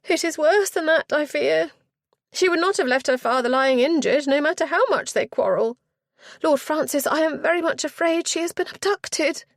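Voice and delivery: high, loud fearful telling; a frightened lady telling the lord of the abduction, high and loud; shuddering dread. Acted. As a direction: break the terrible news. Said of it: "Miss Poynton shuddered".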